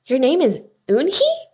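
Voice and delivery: astonished, pitch climbing high at the end; wide-eyed question. A narrator's reading. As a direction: ask it with eyes open wide. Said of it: "he asked her with his eyes open wide".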